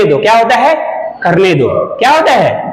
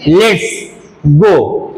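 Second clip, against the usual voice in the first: slow model phrase; much slower than usual. Shown as a demonstration. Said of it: "क्या बोलते हैं?".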